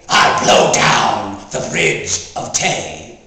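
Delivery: very low, slow menacing demon. Acted. Demon of the air threatening the bridge, deep demon voice. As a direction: boom it out deep, word by word. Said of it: "the demon of the air seemed to say".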